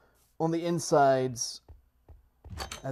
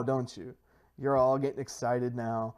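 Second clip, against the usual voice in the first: ordinary talk, nothing marked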